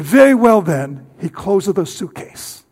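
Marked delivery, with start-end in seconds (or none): 0.0-1.1 loud, higher put-on officer's voice; stern Soviet officer. Soviet officer letting the Bibles through, in a loud stern voice. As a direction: imitate an officer grudgingly giving way. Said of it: "in his mean tone of voice".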